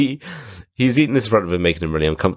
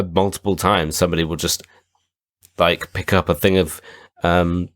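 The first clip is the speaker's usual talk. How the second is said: usual speaking voice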